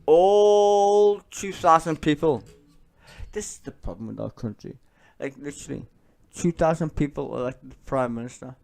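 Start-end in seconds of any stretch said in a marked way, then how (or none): 0.1-2.4 ALL dragged out in disbelief; drawn-out scoff. Real, not acted. Streamer scoffing at two thousand voters, stretched loud. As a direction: stretch every word slow and loud, higher.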